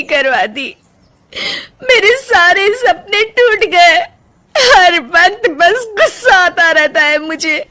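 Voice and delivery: slow, broken voice through tears; sobbing as she speaks of painting; tearful confession. Acted. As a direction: cry out her lost dreams. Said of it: "Rajni फूट फूट कर रोने लगती है".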